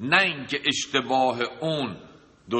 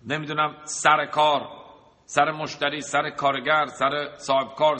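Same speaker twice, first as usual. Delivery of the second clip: ordinary talk, nothing marked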